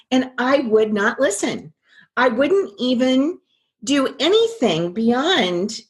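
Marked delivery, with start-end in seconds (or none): none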